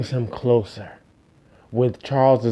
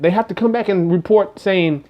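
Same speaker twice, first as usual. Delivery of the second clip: ordinary talk, nothing marked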